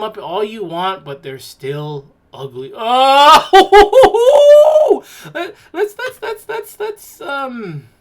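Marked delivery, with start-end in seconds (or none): 3.5-5.3 high, loud shriek of a laugh. Real, not acted. Vlogger sees another ugly dog and shrieks with laughter. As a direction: burst into a squealing laugh. Shocked by the next dog photo.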